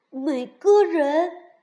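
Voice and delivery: loud, high, drawn-out disbelief; astonished. A narrator's reading. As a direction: echo it as if you cannot believe it. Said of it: "惊讶地问". (惊讶地问)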